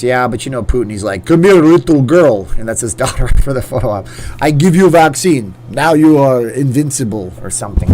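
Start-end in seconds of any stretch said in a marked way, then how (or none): 1.3-2.5 slow, louder, put-on bad Russian accent as Putin; hammed-up Russian accent, slow and menacing. Joking about Putin with his daughter, bad Russian accent. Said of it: "That's a very bad Russian accent". 4.4-7.3 louder, hammed-up bad Russian accent. Putin giving his daughter the vaccine, bad Russian accent. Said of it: "That's a very bad Russian accent".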